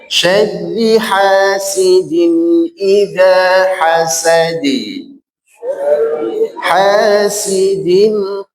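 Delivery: very slow, sustained tajwid chant; Quran recitation. Shown as a demonstration. Teacher models the verse before counting, slow chant. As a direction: chant evenly with long held nasals and vowels.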